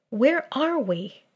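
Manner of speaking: mildly irritated question; lightly peevish and slow. A narrator's reading. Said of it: "Her voice turned peevish".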